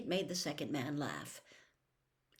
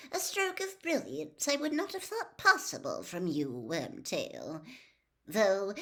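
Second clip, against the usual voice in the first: lightly voiced cold, commanding lord; calm menace handing out orders; a dark lord instructing his servant; cold master; 3 semitones higher, 3 dB louder than usual. A narrator's reading. The cold lord mocks Wormtail.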